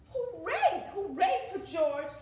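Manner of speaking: loud, high cheer; cheering shout. Acted. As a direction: cheer for the hero. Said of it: "Everyone shouted".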